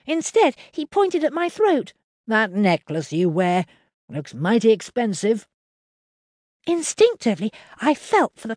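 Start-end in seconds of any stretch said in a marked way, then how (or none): 2.3-5.5 lightly voiced farmer, eyeing the necklace; Henry the farmer. A narrator's reading. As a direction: let greed creep into the countryman's words.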